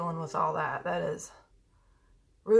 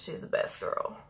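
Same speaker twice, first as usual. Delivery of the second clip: whispered, lower and soft; 6 semitones lower, 8 dB quieter than usual. Real, not acted. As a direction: murmur it to the dog. Cooing at the dog.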